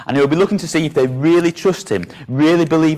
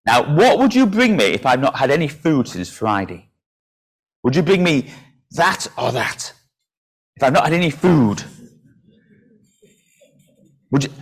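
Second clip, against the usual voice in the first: ordinary delivery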